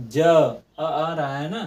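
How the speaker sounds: ज अ drawn out slowly to split consonant and vowel; slow sound demo. Shown as a demonstration. Teacher splitting ज for pupils, slow and drawn out. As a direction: pull ज apart slowly into its vowel. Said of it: "ज बोल कर देखिए".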